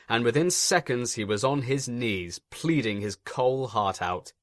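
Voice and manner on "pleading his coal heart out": plain reading voice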